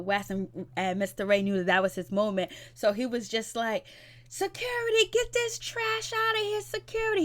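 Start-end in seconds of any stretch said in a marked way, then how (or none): 4.3-7.2 very high gleeful squeal of a summons; gleeful mock Ray. Vlogger mimics Ray gleefully calling security, high squeal.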